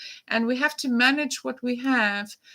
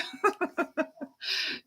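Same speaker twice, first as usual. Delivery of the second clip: soft laugh; 5 semitones higher, 7 dB quieter than usual. Real, not acted. Laughs at quiet chat.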